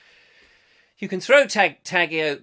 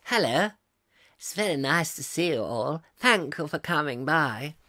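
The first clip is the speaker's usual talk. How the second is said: silly hat character. Pretend.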